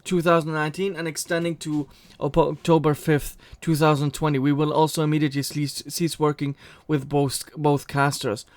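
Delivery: usual speaking voice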